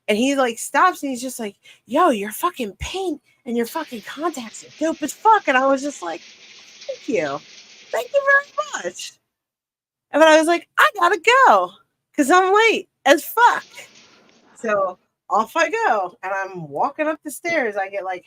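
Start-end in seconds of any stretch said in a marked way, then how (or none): 1.9-5.5 higher-pitched, impressed outburst; retold excited compliment. Friend admiring the clown paint and contacts, excited. 6.2-9.1 high, put-on little thank-you; retold squeaky thanks. Clown thanking an admirer for the compliment, squeaky. 10.8-13.7 high, loud, rushed-off exclamation; retold urgent outburst. Performer running late breaks off the chat, urgent yell.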